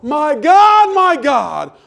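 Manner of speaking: full-voiced high shout to heaven; anguished cry. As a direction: cry out with all your might, pitch soaring. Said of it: "he's crying out".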